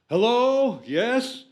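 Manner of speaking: high, loud, put-on phone greeting; mock phone answer. Jack picking up the studio phone, high and loud. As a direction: chirp hello like an actor answering.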